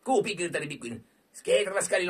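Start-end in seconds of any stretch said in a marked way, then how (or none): none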